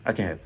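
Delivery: "can" as a short "ken", no lingering; quick, light CAN. Shown as a demonstration. Teacher demonstrating "I can help" to learners, quick light "can". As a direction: pass straight over "can" to "help". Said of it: "Tu ne perds pas le temps dessus".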